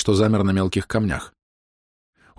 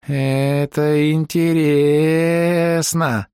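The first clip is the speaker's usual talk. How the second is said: high, very slow odd drawl; strange elf voice; 7 semitones higher, much slower than usual. A narrator's reading. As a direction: speak it slow and eerie. Odd bird-elf character speaks.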